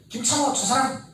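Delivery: hammed-up student jab; mock complaint about teacher. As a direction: point at the teacher and gripe. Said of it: "이런 뜻이 아니지".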